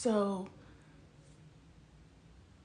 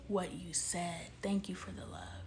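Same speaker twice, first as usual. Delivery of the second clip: whisper; 2 semitones lower, 6 dB quieter than usual. Real, not acted. Coach to viewer, dropping suddenly into a whisper. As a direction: lean in and whisper the words.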